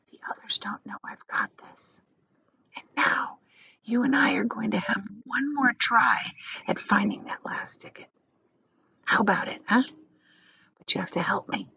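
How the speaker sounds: conspiratorial unvoiced whisper of an old grandfather; old man whispering. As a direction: hush it like a shared secret.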